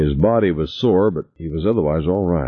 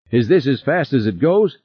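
higher-pitched urgent question; anxious; 7 semitones higher than usual. A narrator's reading. Passenger to pilot during the escape ascent, anxious.

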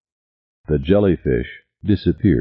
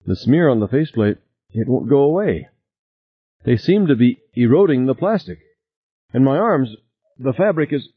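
worried; 8 semitones higher than usual. A narrator's reading. Diver in trouble over intercom.

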